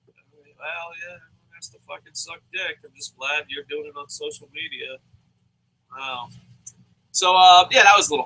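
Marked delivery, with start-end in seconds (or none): 0.1-6.3 much softer sarcastic aside; muttered aside. Real, not acted. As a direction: drop it under your breath.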